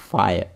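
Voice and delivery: फायर said as "fa-yar", with a y glide; demo of फायर. Shown as a demonstration. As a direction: model the word for the class. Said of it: "इस तरह से ये शब्द इन पे जोड़े जाते हैं".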